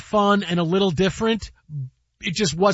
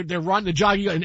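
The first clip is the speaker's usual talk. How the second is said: ordinary delivery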